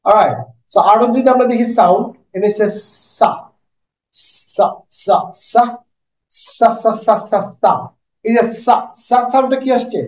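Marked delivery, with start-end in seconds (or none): 3.2-7.7 সা hissed like a snake, tongue behind the teeth. Shown as a demonstration. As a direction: hiss সা like a snake. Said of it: "সাউন্ডটা বেশ সাপের মতো". (সাপের মতো সা)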